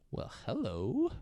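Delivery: soft, slow, swooping come-on; mock flirtatious. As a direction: croon it softly and slowly at a pretty stranger. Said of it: "Marv looks at her, and he goes".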